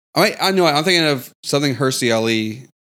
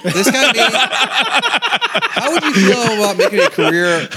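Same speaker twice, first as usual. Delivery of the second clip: laughing mock rant; 9 semitones higher, 3 dB louder, slower than usual.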